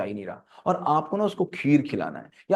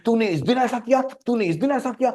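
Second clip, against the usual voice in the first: put-on higher, accusing spouse dredging up old grievances; accusing quarrel voice; 8 semitones higher than usual. Spouse in a fight recalling past wrongs, heated accusation. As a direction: throw the old fault back at them, heated.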